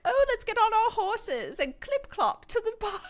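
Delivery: very high mock posh voice; posh horse rider. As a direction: prance it like a fancy rider. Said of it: "And then we'll be like".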